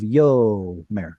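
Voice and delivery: "yomer" with a drawn-out, stressed YO. Shown as a demonstration. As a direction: hold yo, then drop a light mer in "yomer". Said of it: "starts the stress syllable".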